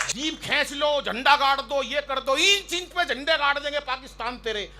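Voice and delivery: high-pitched heroic recital parody; mock veer ras poet. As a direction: spoof the fiery patriotic poet's lines. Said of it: "वीर रस के कवि होते हैं".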